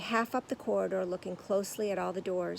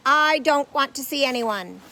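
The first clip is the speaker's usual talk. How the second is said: booming refusal; 6 semitones higher, 13 dB louder than usual. Acted. Booming actor rebuffs visitor.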